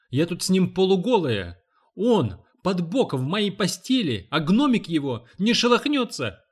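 высокий возмущённый крик; кричи, срываясь на высокие ноты. A narrator's reading. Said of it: "Миссис Ральф переходит на крик". (кричит в ярости)

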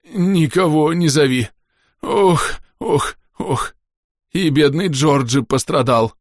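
slow, high, moaning creature voice; distorted underwater creature. A narrator's reading. Hurt underwater creature laments its cracked shell, distorted pleading. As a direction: groan and plead slowly in a strange voice. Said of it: "слова исконно подводного жителя звучали немного искажённо".

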